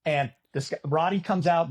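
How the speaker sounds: normal talking voice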